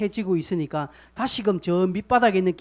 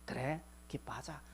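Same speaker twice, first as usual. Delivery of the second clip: forced resolve; 5 semitones lower, 15 dB quieter than usual. Acted. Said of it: "의도적으로 입꼬리를 올리는 거예요".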